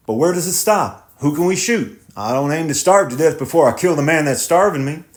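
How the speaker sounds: lightly voiced thoughtful farmer; tenant farmer voice. Tenant despairs.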